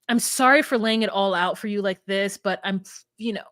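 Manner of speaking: normal talking voice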